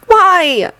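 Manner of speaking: put-on high choked boy's voice; a lightly acted choke; choked cry. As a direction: Anakin choking out a protest through tears. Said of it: "he choked".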